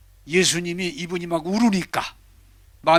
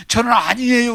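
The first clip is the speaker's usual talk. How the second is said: higher, louder pleading denial; tearful protest; 6 semitones higher, 6 dB louder than usual. Acted. The weeping woman protests to God, higher and louder. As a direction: cry out you're not worthy. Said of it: "막 울면서".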